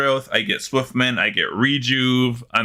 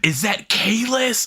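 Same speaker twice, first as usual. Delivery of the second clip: high, slow starstruck question; mock fangirl gasp of recognition; freaking-out fan; 10 semitones higher, slower than usual. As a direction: draw it out like an awestruck fan. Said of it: "That's what I feel like".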